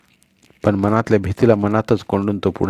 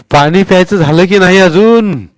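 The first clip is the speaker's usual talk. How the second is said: put-on old Patil's voice; 8 semitones higher, 9 dB louder than usual. A narrator's reading. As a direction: boom down at the little boy from the well. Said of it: "Patil आजोबांचा आवाज".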